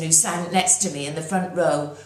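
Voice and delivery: plain reading voice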